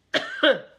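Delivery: sharp loud cough. Real, not acted. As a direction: cough out suddenly. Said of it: "that this cough".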